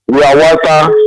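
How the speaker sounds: ordinary talk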